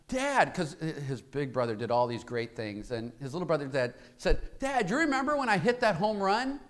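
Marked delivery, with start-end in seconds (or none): 0.1-0.5 put-on high boyish call to dad; higher child voice; boy's plea. Boy pleading with father. 4.6-6.6 put-on high, quick child's voice; eager boy. Boy eagerly asks father.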